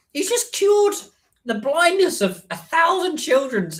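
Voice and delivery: incredulous. Retold or imitated. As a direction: squeal it out in amazed disbelief. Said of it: "I was like".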